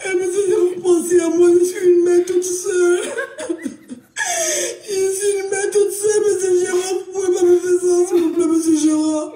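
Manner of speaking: over-the-top sobbing plea; wailing plea. Acted. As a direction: beg the manager like a single mother in tears.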